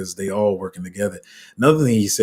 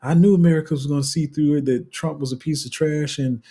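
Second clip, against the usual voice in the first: smug Democrat; 6 semitones higher than usual. Retold or imitated. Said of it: "And I said".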